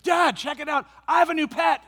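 high, loud and thrilled, playing his daughter; excited little girl. Daughter proudly showing dad her new pet, high and excited. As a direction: squeal it like a kid showing off a frog.

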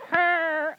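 high squeal of the door closing; imitated garage door noise. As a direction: mimic the metal screech of the door. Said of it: "It was like".

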